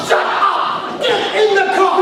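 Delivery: high-pitched frantic shout; mad guy. Acted. Shouting at Bill to get in the car, frantic. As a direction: yell it like a man possessed. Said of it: "be like a mad guy going".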